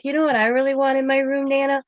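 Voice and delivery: light mock child voice; imitating granddaughter. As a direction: coax Nana like a little girl. Granddaughter voiced asking for desk.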